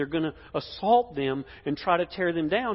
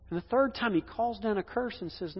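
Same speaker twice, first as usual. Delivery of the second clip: ordinary delivery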